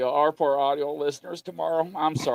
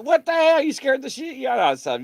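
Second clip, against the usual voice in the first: startled outburst; 10 semitones higher than usual. Retold or imitated. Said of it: "And it was like".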